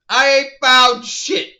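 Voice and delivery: drawn-out mock complaint, lightly voiced; Tim Russ line. Reviewer joking his buddy would cameo, slow mock gripe. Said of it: "my buddy Tim Russ in and say".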